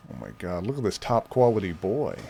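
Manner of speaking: usual speaking voice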